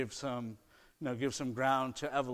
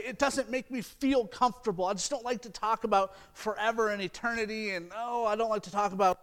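higher, whiny mock voice of someone dodging eternity; mock reluctant believer; 5 semitones higher, 4 dB louder than usual. Preacher mimicking a congregant avoiding talk of heaven, higher whiny voice. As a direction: imitate the people who brush off talk of forever. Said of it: "We can't say".

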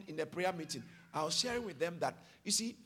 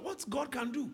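ordinary talk

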